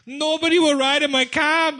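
high, loud whiny complaint; complaining cabbie. Retold or imitated. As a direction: whine it like the cabbie. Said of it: "Comes back the next day".

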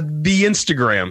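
slow, lightly mocking old-timer; a hint of a slow old man; imitating an eighty-five-year-old man. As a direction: tease him with a halting, elderly delivery. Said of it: "talk like an eighty-five-year-old man".

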